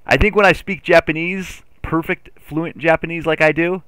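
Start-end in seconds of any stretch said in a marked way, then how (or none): none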